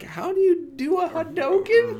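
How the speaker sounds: clueless newbie. Retold or imitated. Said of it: "I'm over here like".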